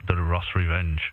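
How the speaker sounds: ordinary delivery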